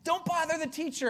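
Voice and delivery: high, scolding crowd voice; annoyed crowd rebuke. Acted. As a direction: tell off a noisy beggar. Crowd hushing the beggar.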